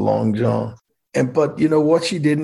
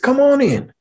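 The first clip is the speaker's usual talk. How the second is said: very high, louder mock invitation; Mark Roscoe; 5 dB louder than usual. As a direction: boom out the governor's welcome. Governor invites him in.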